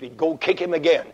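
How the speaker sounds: ordinary talk, nothing marked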